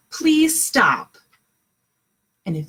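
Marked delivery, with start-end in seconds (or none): 0.1-1.2 louder, firm please stop; firm calm request. Shown as a demonstration. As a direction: say it firmly to a sibling. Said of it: "and you can say".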